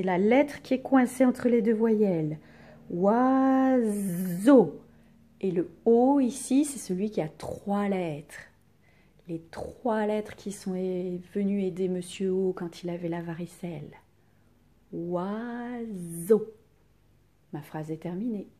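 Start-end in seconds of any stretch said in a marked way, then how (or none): 2.9-4.8 "Oiseau" stretched slowly, louder, z buzzed; drawn-out syllables. Shown as a demonstration. Teacher dictating oiseau to pupils, drawn out. 14.9-16.5 "Oiseau" slowly stretched at an ordinary level; drawn-out syllables. Shown as a demonstration. Teacher writing the last word for pupils, drawn out.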